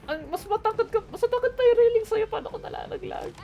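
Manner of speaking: high teasing voice. Pretend. Teasing friend who fell off.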